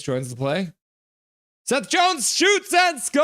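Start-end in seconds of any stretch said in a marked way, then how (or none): 1.6-3.2 high, loud, slow announcer shout; goal call. Acted. Streamer calling the goal, a loud broadcaster shout.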